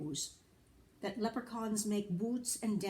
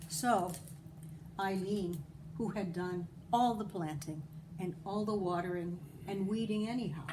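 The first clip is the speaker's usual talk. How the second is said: plain reading voice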